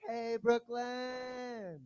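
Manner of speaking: high, loud, stretched-out whoop; long high stage cheer; excited crowd greeting. Real, not acted. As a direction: holler hello to the whole audience. Performer greets the crowd on entering.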